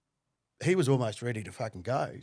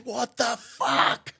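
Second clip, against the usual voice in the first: high, loud, drawn-out disbelief; stunned shout; 11 semitones higher, 6 dB louder, much slower than usual. Retold or imitated. Back-seat passenger seeing the stabbing, stunned shout. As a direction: stretch each word out in horrified shock.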